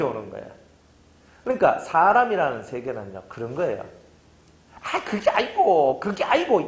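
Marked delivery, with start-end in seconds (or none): 4.8-6.6 slow, higher, drawn-out lecturing; correcting tone. Retold or imitated. Someone lecturing a hurting friend, slow and high. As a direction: stretch it like a know-it-all. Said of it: "이런 거 많이 하지 마세요".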